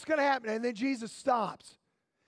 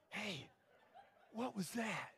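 soft, wondering question; a quiet, puzzled aside; hushed puzzlement; 6 semitones lower, 17 dB quieter than usual. Acted. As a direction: ask it under your breath, confused. Blind man hears Jesus spit.

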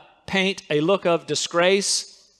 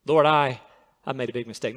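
humble prayer; 6 semitones lower, faster than usual. Shown as a demonstration. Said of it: "This is how you talk to God".

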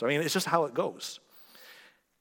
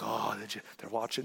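mock paranoid hush; 6 semitones lower, 9 dB quieter than usual. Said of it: "he's like".

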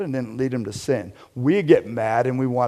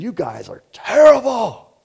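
higher, louder mock rant at the crowd; mock angry outburst; 10 semitones higher, 7 dB louder than usual. Pastor mocking an angry Jesus, hammed-up yell. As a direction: fling it like a hammed-up insult. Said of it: "wasn't spitting and throwing rocks".

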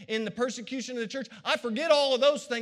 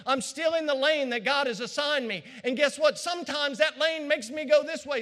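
preaching build; 4 semitones higher than usual. Acted. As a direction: preach it with growing fire.

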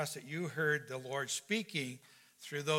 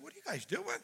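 high, softened disbelief; baffled question; 9 semitones higher, 7 dB quieter than usual. Retold or imitated. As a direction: squeak out an incredulous question. Pastor acting baffled question.

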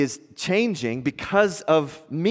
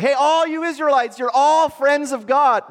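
higher and louder, like a herald calling out to a crowd; mock grand proclamation; 10 semitones higher, 4 dB louder than usual. Preacher imagining a proclamation to all Israelites, booming and higher. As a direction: announce it to all Israel, arms wide. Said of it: "it wasn't like".

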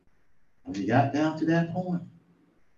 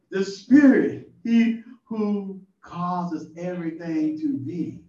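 ordinary delivery

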